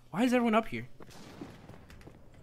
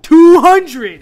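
a loud, high yelp at the number; shocked shout; 11 semitones higher, 25 dB louder than usual. Real, not acted. Gamer yelling at the two hundred ping on screen, shocked. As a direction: shout it out in real disbelief.

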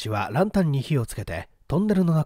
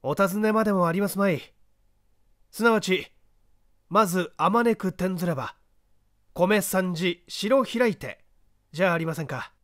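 calm, assured voice of the detective, a touch higher than the narration; a lightly voiced, unhurried gentleman sleuth; composed gentleman detective; 6 semitones higher than usual. The detective calmly directing the man up the ladder.

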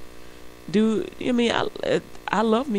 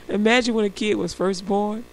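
ordinary talk, nothing marked

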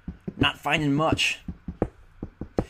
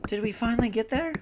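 hopeful squeak; 11 semitones higher than usual. Retold or imitated. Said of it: "I'm like".

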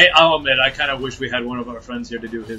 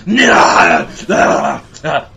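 mock scream; 3 semitones higher, 10 dB louder, much slower than usual. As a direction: yell like a victim being bitten. Victim screaming.

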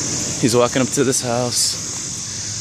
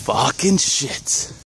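ordinary talk, nothing marked

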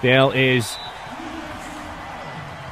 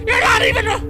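furious shout. Real, not acted. Rage at game betrayal.

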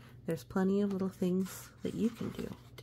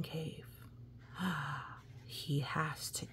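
hushed storybook whisper; hushed suspense whisper for children; spooky whisper; 2 semitones lower, 9 dB quieter than usual. A narrator's reading. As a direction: whisper it like entering a dark cave. Spooky cave moment, unvoiced.

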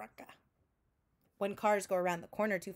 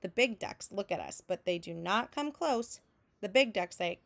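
usual speaking voice

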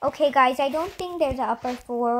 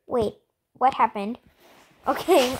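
ordinary talk, nothing marked

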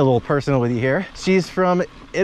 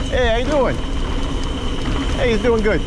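Mister Bonelli greeting; 9 semitones higher than usual. Acted. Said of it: "Like the ghost of Mister Bonelli is".

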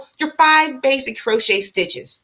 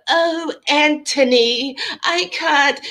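slow, high mock-British lady; hammed-up British accent, drawn out and pitched up; horrible British accent; 6 semitones higher, much slower than usual. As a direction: imitate an audiobook narrator's British accent, badly. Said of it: "British accent".

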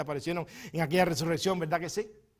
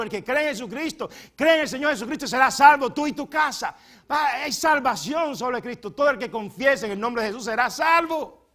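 loud, higher preaching; full-force preaching; 7 semitones higher, 13 dB louder than usual. Acted. Preacher exhorting the congregation, loud and high. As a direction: proclaim it to the whole church.

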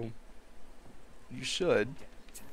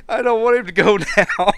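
laughing; 8 semitones higher, 8 dB louder than usual. Real, not acted. Laughing over Sim death.

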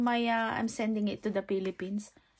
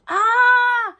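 excited squeal; 11 semitones higher, 10 dB louder than usual. Real, not acted. Husband brings the lamp.

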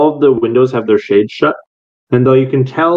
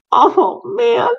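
put-on high, whiny kid's groan; a disappointed kid, voice jumping way up; sulky child pitched high; sad kid. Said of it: "You hear this sad kid go".

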